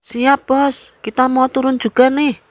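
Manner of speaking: put-on high-pitched, awkward Betawi accent; stiff Betawi accent. A narrator's reading. Uncle answers the bus conductor, stiff Betawi accent. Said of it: "dengan logat Betawi kakunya".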